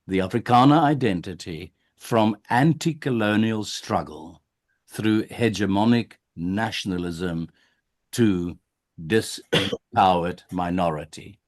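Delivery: careful reading. Shown as a demonstration. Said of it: "I wanna read it very careful".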